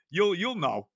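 ordinary delivery

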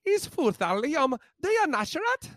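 high-pitched mangled Irish Gaelic ad read; hopelessly off Irish Gaelic accent; botched Irish Gaelic. Acted. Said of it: "Irish Gaelic here".

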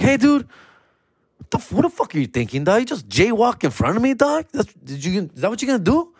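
hammed-up furious cop; mock angry cop. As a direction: scold him like an offended cop. Angry cop confronting him.

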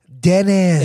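flattened Eagle Rock Filipino vowels; Eagle Rock accent. Shown as a demonstration. Host correcting the guest's try, Eagle Rock accent. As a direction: say it Eagle Rockish, flat "eh" in "Dennis". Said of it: "pag na Eagle Rockish ka na".

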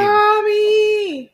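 high, drawn-out squeal of a name; mock fan scream. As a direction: call out like a swooning fan. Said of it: "Así mismo le voy a gritar".

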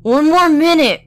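high pleading plea; begging wife. A narrator's reading. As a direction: stall him, pitched high. Wife begs.

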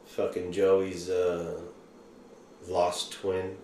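ordinary talk

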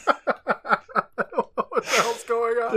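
high, laughing disbelief. Real, not acted. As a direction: laugh through the words.

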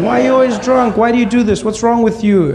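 high-pitched angry nagging; mom shouting. Retold or imitated. Mother confronting her drunk husband, mock shouting. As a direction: imitate a mother scolding, voice way up. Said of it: "she started to shout at him".

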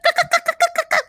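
very high, loud bird cawing; scared bird squawking in a burst; chirping bird person. Retold or imitated. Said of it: "sounds just like a bird".

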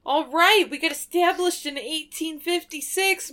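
exaggerated New York accent reading the can; Brooklyn-style beer label read. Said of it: "You gotta say it with a New York accent".

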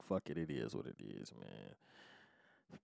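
low, very soft, trailing away; resigned murmur. Retold or imitated. Man giving up on going straight, resigned murmur. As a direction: let it sink to nearly nothing. Retelling his resigned decision.

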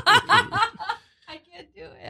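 high, slow, laughing helplessly; cracking up mid-sentence. Real, not acted. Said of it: "I'm laughing".

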